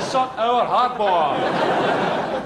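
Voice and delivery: very slow, prim official delivery through a laugh; spokesperson voice. Pretend. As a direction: read it like a pompous spokesman, stretching every word. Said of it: "I get my spokesperson to say it".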